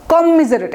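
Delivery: কমিজারেট in one quick breath, কম joined to মিজারেট; said together. Shown as a demonstration. Teacher correcting students, কমিজারেট joined in one breath. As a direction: glue কমিজারেট into one word. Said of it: "একসাথে বলবেন কমিজারেট".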